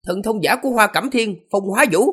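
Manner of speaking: high, bright youthful self-introduction; young man voice. Acted. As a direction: announce your name as a proud youth. Young warrior introduces himself.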